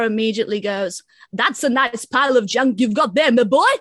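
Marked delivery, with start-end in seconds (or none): 1.3-3.7 put-on eccentric old grandpa, swooping up loud at the end; hammed-up impression of a batty film grandpa; insane grandpa voice. Cohost mimicking the film's grandpa seeing the car, insane grandpa voice. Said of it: "the voice that he does is absolutely insane".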